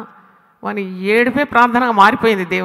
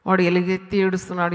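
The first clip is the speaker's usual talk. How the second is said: ordinary delivery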